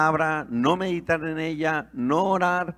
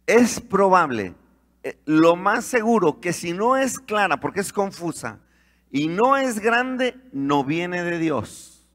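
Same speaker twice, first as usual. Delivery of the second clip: louder, emphatic, slowed preaching; fervent preaching; 4 semitones higher, 4 dB louder than usual. Acted. Preacher warning the congregation about false visions, fervent. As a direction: drive the warning home from the pulpit.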